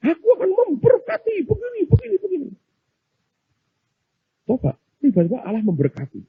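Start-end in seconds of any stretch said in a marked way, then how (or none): 0.1-2.5 high-pitched, mock-grand promise; bold talk. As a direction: imitate someone making brave vows in a very high voice. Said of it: "Beraninya kalau ngomong". (pura-pura berjanji lantang, nada tinggi)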